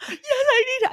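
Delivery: very high, loud tearful wail; comic retold sobbing outburst; mock crying. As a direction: blubber it, hammed up for laughs. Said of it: "And I started crying".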